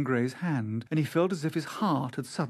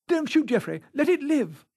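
high urgent cry to stop; 12 semitones higher, 4 dB louder than usual. A narrator's reading. Dorian urging his friend not to shoot, crying out. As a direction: plead loudly, pitched right up. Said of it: "he cried out at once".

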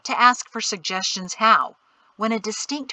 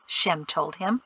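ordinary delivery, nothing marked

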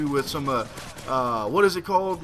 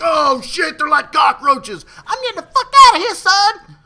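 high, loud hammed-up panic; facing a room of enemies, hammed-up panicked yell; mock panic; 11 semitones higher, 10 dB louder than usual. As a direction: shriek like fleeing cockroaches. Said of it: "that's what was going through my head".

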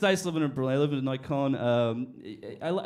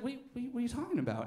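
fast flustered; 7 semitones higher, 6 dB quieter, much faster than usual. Retold or imitated. Guy acting innocent.

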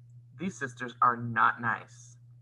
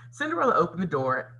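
usual speaking voice

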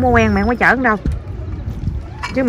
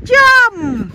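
calling jump; 12 semitones higher than usual. Real, not acted. Mother counting her son into the water, jump called out high. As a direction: jump sung out much higher.

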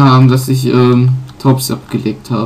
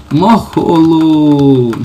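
slow drawn-out taunting hello; mock greeting; 3 semitones higher, 5 dB louder, much slower than usual. Gamer taunting Team Rocket rival, slow mock hello. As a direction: stretch it out teasingly at the rival.

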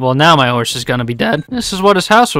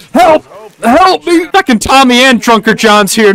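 high, loud panicked yell; yelling for help; 8 semitones higher, 5 dB louder than usual. Real, not acted. Gamer mobbed by Tommy and Drunker John, high panicked yell. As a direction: shout for help mid-fight. Attacked by enemies in game.

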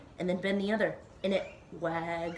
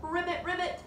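high, playful frog croaks; perky doubled frog call; frog ribbit; 9 semitones higher than usual. Pretend. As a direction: ribbit like a frog for small children. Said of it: "like a frog".

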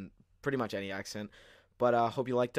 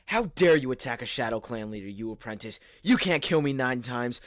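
angry threat; 3 semitones higher, 7 dB louder than usual. Acted. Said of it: "Broke Star".

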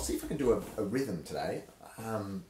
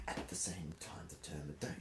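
whispering; 4 semitones lower, 9 dB quieter than usual. Shown as a demonstration. Sudden hush in dramatic reading.

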